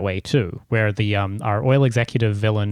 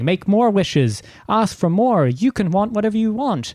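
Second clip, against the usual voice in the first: villain quote; 10 semitones higher than usual. Retold or imitated. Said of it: "as he's saying".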